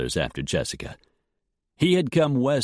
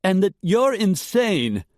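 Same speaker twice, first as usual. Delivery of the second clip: high, loud, slow accusation; angry accusation; 12 semitones higher, 4 dB louder than usual. A narrator's reading. Jessica cutting in on Dietrich, angry. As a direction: spit out the accusation. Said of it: "Jessica broke in".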